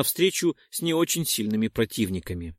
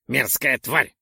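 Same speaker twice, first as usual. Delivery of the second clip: mild snort of disgust; 3 dB louder, slower than usual. Acted. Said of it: "фыркнул Ролдон".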